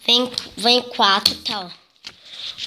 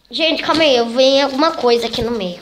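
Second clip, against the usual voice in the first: flustered; 3 semitones higher, 7 dB louder than usual. Real, not acted. Kid searching for sticker, flustered.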